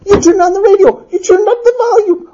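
high-pitched husband listing grievances; exasperated accusation. Acted. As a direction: lay out each charge high-pitched. Husband accusing in acted quarrel.

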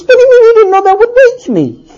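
high-pitched, exasperated husband; incredulous outrage. Acted. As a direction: squeal the question in disbelief. Husband incredulous in acted quarrel.

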